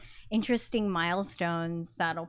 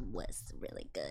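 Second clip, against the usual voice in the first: whisper; 15 dB quieter than usual. Retold or imitated. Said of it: "so I was like".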